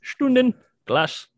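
loud, high mock-German "Stunden glass"; hammed-up German accent, slow and drawn out; badly faked German pronunciation, SHTOON-den; terrible German accent. Shown as a demonstration. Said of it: "Sorry for the terrible accent".

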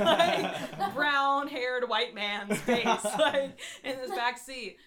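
loud, high, breaking into laughter; laughing through words. Real, not acted. Storyteller describing a blurred photo, cracking up.

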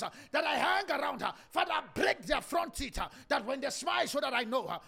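loud, driving preaching prayer, speaking in tongues; fervent shouted prayer. Real, not acted. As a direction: pray at full force, crying out to God.

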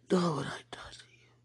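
weeping hushed whisper; tearful whisper. Real, not acted. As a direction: whisper through tears.

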